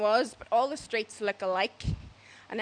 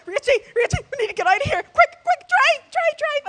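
shrieking, much higher and louder; panicked screaming; 7 dB louder than usual. Retold or imitated. Liz screaming at the driver to go, shrieking. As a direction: yell in terror. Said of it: "was like".

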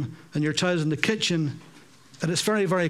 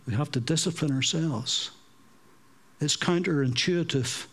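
ordinary delivery

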